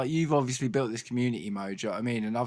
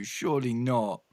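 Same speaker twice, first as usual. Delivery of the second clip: usual speaking voice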